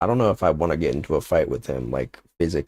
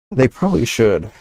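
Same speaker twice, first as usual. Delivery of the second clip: higher and louder, emphatic repeat; 6 semitones higher, 5 dB louder than usual. Real, not acted. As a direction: punch it up with conviction. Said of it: "repeats it, louder and higher".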